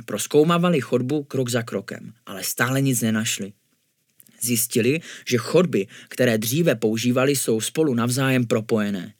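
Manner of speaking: ordinary delivery, nothing marked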